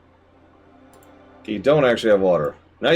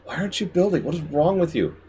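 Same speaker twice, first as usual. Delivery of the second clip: high, strained pleading at the screen; high-pitched frustrated shout at a game; exasperated yelling at the game; 9 semitones higher, faster than usual. Real, not acted. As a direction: scold the stubborn city.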